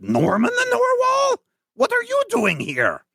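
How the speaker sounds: very high, thrilled surprise; Doctor West. Acted. Doctor West greeting the narwhal, very high and thrilled. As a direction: squeal with delight at an old friend. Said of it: "he's like".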